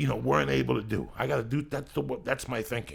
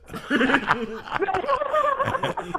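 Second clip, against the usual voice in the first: big high laugh. Real, not acted. Cracking up at his own cancer joke, big high laugh. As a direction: crack up at your own punchline.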